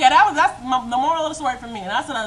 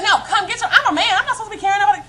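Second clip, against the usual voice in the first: higher, louder, laughing mock of him; angry mock man; 8 semitones higher, 4 dB louder, faster than usual. Boyfriend refuses to carry purse, mocked angry and laughing. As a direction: imitate him huffing about manhood. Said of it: "mad as I don't know what".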